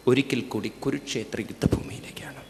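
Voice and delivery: soft whisper of a secret; hushed reveal. A narrator's reading. Chariot heading back to Kurukshetra, whispered. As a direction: breathe the destination like a secret.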